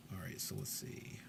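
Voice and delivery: whispered to self. Real, not acted. Player mutters while rolling dice.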